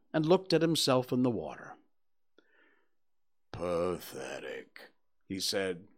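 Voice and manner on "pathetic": very low, slow and mournful Eeyore; gloomy donkey. A narrator's reading. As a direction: drag it out like a sad old donkey. Eeyore speaks, read aloud.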